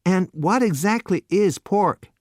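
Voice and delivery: put-on young man confessing ignorance to waiter; innocent youth. A narrator's reading. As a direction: guileless and honest. Innocent question.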